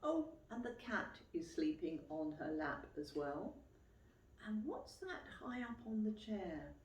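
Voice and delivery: usual speaking voice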